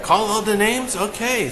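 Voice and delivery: wife repeating the odd request, puzzled; a touch of surprised confusion. Retold or imitated.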